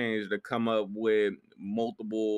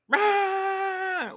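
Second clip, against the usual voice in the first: weak high dinosaur roar; a half-hearted dinosaur roar. Retold or imitated. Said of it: "your worst dinosaur impression ever".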